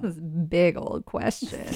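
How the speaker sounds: slow suggestive drawl; innuendo. Pretend. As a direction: lean into the double meaning. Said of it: "like it's innuendo".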